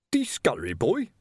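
icy, affronted butler; frosty, offended servant. A narrator's reading. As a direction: repeat it with cold disdain. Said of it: "Now the butler's tone was icy".

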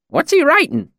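put-on high, nosy old villager; curious old man. A narrator's reading. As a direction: peer and ask, intrigued. Cobb voiced.

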